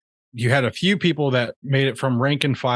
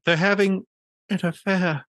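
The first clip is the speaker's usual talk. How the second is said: higher-pitched quoted gossip, lightly acted; imitating film character; 7 semitones higher than usual. Retelling how she says it in the film, imitated higher voice. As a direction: mimic her line in a raised pitch. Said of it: "the way that she says".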